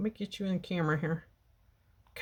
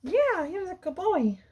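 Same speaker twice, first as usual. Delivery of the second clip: cooing praise; 13 semitones higher than usual. Real, not acted. Praising cat.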